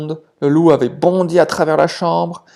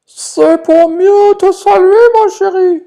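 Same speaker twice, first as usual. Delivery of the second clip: wolf as grandma; 9 dB louder than usual. Acted. (le loup déguisé, voix de mamie très aiguë et lente)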